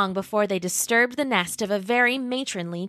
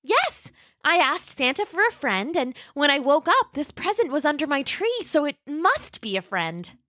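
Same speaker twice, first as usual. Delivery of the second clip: put-on high, sweet little dinosaur girl voice; Roxy the young T-Rex; 6 semitones higher than usual. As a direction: play a polite, eager young T-Rex. Roxy explains again.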